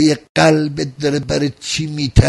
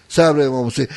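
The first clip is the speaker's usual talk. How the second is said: ordinary talk, nothing marked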